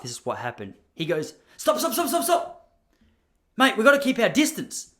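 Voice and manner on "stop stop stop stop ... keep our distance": high, loud frantic stop-stop-stop; panicky postman. Retold or imitated. Postman ordering the speaker to keep away, high and panicked. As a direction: yelp it like a terrified postie.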